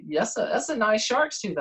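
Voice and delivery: high put-on voice of an admiring old-timer; voiced old man. Old man at the dealership admiring a tooth, imitated in a high voice.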